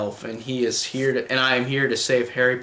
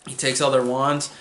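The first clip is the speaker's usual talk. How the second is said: ordinary delivery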